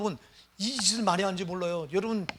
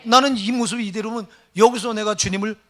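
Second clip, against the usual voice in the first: louder, higher preaching push; fervent preaching; 4 semitones higher, 8 dB louder than usual. Acted. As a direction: drive the point home to the congregation. Preaching at full force. (열정적인 설교)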